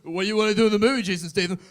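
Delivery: loud mock Stallone bellow; a loud, hammed-up director shouting his question; shouted Stallone question. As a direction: call out to the cast like a bossy director. Stallone asking cast, shouted.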